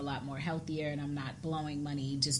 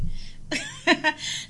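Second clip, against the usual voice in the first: high, real burst of laughter; amused laughter; 12 semitones higher, 4 dB louder than usual. Real, not acted. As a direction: laugh out loud at a friend's silliness. Amused by guest's remark.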